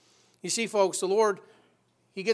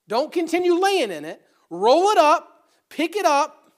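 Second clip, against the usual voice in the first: loud, high "Roll it up"; shouted exhortation; 9 semitones higher, 10 dB louder, slower than usual. Acted. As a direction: punch "Roll it up" to rally them. Preacher's closing exhortation.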